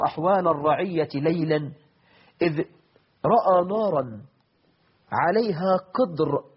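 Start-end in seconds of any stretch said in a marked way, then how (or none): none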